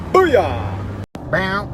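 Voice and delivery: loud, high victory whoop; punchy celebratory yell; triumphant cheer. Real, not acted. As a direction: shout it out in triumph. Celebrates the listing won.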